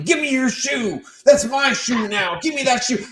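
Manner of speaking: high-pitched mock yelling; retold yelling. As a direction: holler a demand, playfully re-enacted. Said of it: "me yelling".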